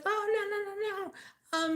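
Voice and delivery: high, hurried denial of a caught woman; mock her high, flustered backpedal. Vlogger imitating the woman behind her in line, high and flustered. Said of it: "she's like".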